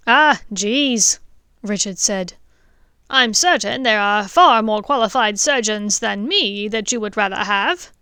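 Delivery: father humbly declines the honour; flustered dentist. Acted. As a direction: protest modestly, caught off guard. Richard flustered.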